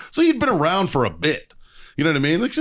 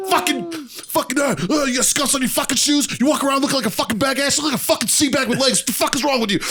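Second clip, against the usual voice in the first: high-pitched ranting tirade; re-enacted drill-instructor rant; laying into him; 11 semitones higher than usual. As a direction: chew out a recruit, pitch way up. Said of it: "fucking laying into this fucking midshipman".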